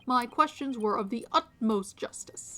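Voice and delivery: loud, emphatic champion of justice; righteous detective. Acted. Detective rejects pleas in the name of justice, righteous. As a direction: declare it like a zealous lawman.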